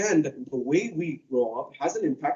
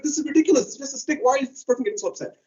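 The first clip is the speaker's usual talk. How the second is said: high, fast incredulous complaint; mock exasperation, pitched high; exasperated youth; 8 semitones higher, faster than usual. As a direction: roll your eyes at the fuss. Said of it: "Like, that, like".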